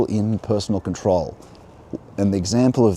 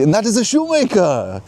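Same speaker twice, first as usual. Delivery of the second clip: very high, loud mock French; French accent; 13 semitones higher, 6 dB louder than usual. Envoy objects to waiting behind a shoemaker, outraged French accent. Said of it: "that's my best French accent".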